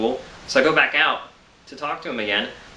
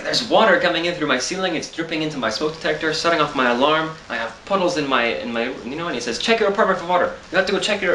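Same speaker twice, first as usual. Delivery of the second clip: a hint of the neighbor's halting English, pitched up; lightly voiced foreign neighbor, insistent; 5 semitones higher than usual. Imitating the neighbor urging him to check for water, pitched up. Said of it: "I'm trying to do his voice".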